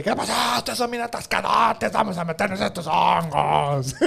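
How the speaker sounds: half-laughing mock rocker voice; mock rowdy guy. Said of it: "como bien critican ellos".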